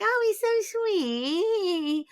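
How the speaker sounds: high, slow, sappy mock coo; mock gushing. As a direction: drip with fake adoration, drawn out. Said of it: "so everyone's like".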